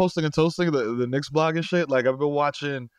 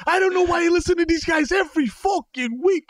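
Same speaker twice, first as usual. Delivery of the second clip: put-on old Italian fan ranting. Still in the yelling fan voice.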